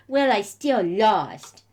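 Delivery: loud, drawn-out petulant complaint; sulky whine. Acted. Heroine glaring at her trainer, sulky whine. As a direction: whine it slowly like a sore loser.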